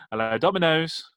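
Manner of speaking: lightly voiced flustered phone greeting; mock receptionist. Imagining herself answering the wrong shop's phone, mild mock fluster. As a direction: fumble the greeting, then apologise. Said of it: "imagine me working in Pizza Hut and be like".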